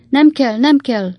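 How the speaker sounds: fussy aunt refusing help, pitched well up; fretful old-maid aunt. A narrator's reading. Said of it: "motyogta a vén kisasszony nagynéni". (magas hangon tiltakozó vénkisasszony)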